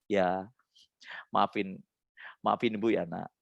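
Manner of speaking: low, soft pleading sorry; quiet parent's apology, with pauses; apologising to child. Shown as a demonstration. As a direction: gently ask your child's forgiveness. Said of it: "minta maaf".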